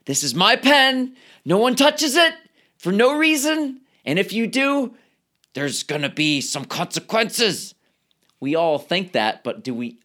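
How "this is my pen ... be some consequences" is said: high, mock-stern warning about the pen; possessive warning. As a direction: lay down the law, half joking. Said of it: "we've got rules about pens".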